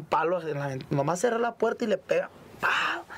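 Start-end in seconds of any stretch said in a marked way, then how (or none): 2.6-3.0 high sudden "pau" for a blow; high-pitched impact noise; imitated bang. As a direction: voice the whack of a stick.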